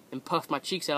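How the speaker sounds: ordinary talk, nothing marked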